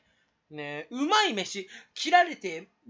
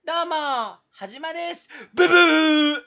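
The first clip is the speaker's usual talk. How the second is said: 高く大声で叫ぶように名乗る; 最後は口でブザー音を張り上げる; 大げさな挨拶芸; 7 semitones higher, 12 dB louder than usual. Shown as a demonstration. 挨拶を考えて試す. (ハイテンションな配信者の挨拶)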